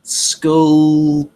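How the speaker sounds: normal talking voice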